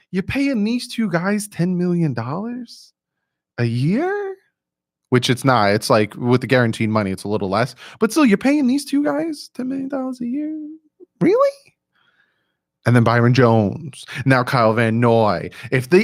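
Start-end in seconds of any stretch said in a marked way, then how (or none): 0.1-4.4 mock disbelief rising into a high squeak on year; a Twitter doubter balking at the money; incredulous doubter. Mimicking doubters on Twitter, incredulous high rise. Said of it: "people on Twitter who thought". 8.0-11.7 high squeaky incredulous rise, Really way up high; mock disbelief. Mocking Twitter doubters, shrill high incredulity.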